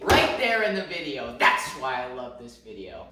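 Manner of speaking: loud, high-pitched, over-the-top boasting; big hammed-up self-promotion, punching the words; mock-serious hype. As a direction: hype yourself up like the star of the show. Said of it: "But on a serious note".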